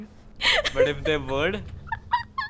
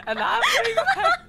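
laughing exasperation; 5 dB louder than usual. Real, not acted.

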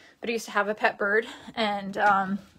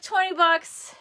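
higher, incredulous twenty bucks; mock surprise; 6 semitones higher, slower than usual. She recalls balking at the price, mock surprise. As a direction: gasp at the price in mock disbelief. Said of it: "I was like".

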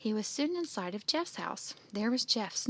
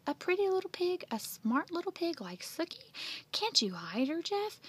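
higher-pitched incredulous questions; shocked; 8 semitones higher than usual. Acted. Andy pleading with Jeff for the pig, shocked. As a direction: protest in alarm, pitch jumping up. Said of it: "Andy's eyes bulged".

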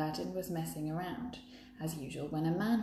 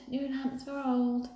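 higher, slow hawker's cry; drawn-out street call; 8 semitones higher, slower than usual. A narrator's reading. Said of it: "he shouted".

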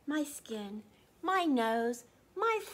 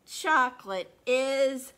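drawn out; 11 dB louder, slower than usual. Acted. Drawn-out build before reveal.

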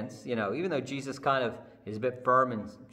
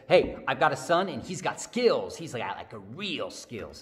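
proud mother; 4 dB louder than usual. Acted. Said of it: "Mary comes and says".

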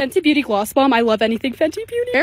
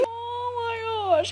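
very slow, much higher, soft oh my gosh; awed gasp; 9 semitones higher, 10 dB quieter, much slower than usual. Real, not acted. Vlogger in awe of giant inflatable Peeps, slow and soft. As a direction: breathe it out in delight.

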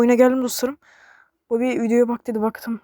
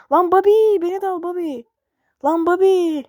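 loud, high call after a friend; 8 semitones higher, 5 dB louder than usual. Real, not acted. Gamer yelling after a flying Bobby, loud and high. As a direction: shout after him as he flies off. Bobby flies away, calls out.

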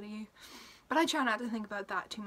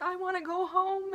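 crying plea; 8 semitones higher, 5 dB louder than usual. Retold or imitated. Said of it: "cry to on a daily basis and just be like".

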